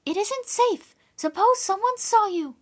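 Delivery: put-on high young girl, eager to help; a kind girl reassuring the guest; girl's voice. Dora reassuring the stranger, high and eager. Dora's voice.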